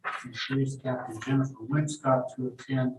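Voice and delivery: normal talking voice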